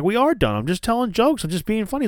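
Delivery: high, flippant mock innocence; mocking high Pat voice. Pat brushing off Adam Cole, high and smug. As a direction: toss it off high and smug, like a joke. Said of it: "And Pat's like".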